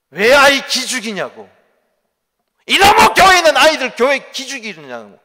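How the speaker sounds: hammed-up shouting at the pastor; retold furious mother, loud and high; angry mother's yell. Mother yelling, pointing at pastor.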